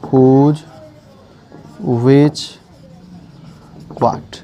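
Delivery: drawn-out single words with long gaps; word by word, spaced far apart; dictating while writing. Real, not acted. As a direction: say each word slowly as you write it. Writing words on board while saying them.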